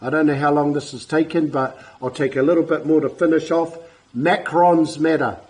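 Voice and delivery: usual speaking voice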